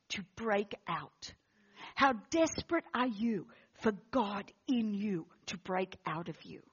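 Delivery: low, soft and searching; hushed preaching appeal. Real, not acted. Preacher voicing God's challenge to the congregation, hushed and intent. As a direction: press each question quietly, with pauses.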